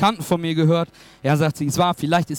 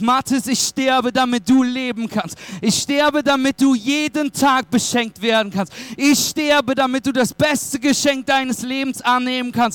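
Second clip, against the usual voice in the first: higher-pitched, insistent preaching in repeated waves; impassioned Jesus quote; 7 semitones higher than usual. Retold or imitated. Preacher voicing Jesus's promise to a believer, impassioned. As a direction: proclaim each promise with fervent conviction. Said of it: "Er hat gesagt".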